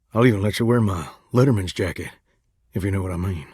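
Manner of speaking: very low, quick seductive murmur; low come-hither crooner; suggestive. Acted. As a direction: drop deep and flirt with innuendo. Suggestive flirting on a date.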